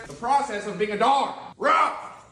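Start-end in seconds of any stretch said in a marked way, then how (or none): 1.6-2.3 a higher, louder barked word; mock bark. Man barking back at his dog Max in fun. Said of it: "I'll rough right at you".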